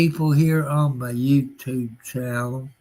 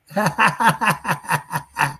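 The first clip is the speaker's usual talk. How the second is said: a chuckle run through the effect box; machine-warped laugh; processed laugh; 4 semitones higher than usual. Shown as a demonstration. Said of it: "Here's a male voice".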